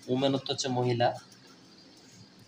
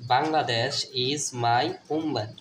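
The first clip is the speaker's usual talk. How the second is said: slow, deliberate sentence reading for learners; slow model reading; much slower than usual. Shown as a demonstration. Teacher reading the sentence aloud to pupils, slow and clear. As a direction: read "Bangladesh is my homeland" slowly and clearly.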